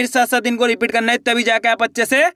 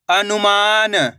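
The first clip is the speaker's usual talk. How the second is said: slow, drawn-out blending of the word; slow clear reading; much slower than usual. Shown as a demonstration. Teacher to pupils, blending the word slowly. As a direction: stretch each syllable for the pupils. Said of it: "एक एक अक्षर का उच्चारण सही से करना".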